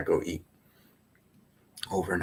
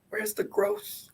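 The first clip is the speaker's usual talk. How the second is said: much higher squeaky Where's the growth with a rise; squeaky mock coach; 7 dB quieter, slower than usual. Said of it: "I'm not gonna then say, like".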